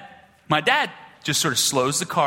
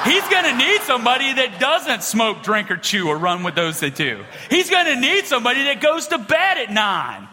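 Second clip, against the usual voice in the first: hammed-up righteous insistence, pitched up; self-righteous child; 6 semitones higher than usual. Ten-year-old convincing dad he's needed, mock self-righteous. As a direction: make the case emphatically, for laughs. Child boasts of his goodness.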